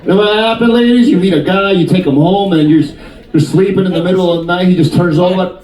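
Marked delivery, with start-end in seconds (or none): none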